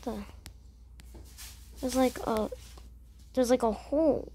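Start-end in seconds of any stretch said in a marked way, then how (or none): none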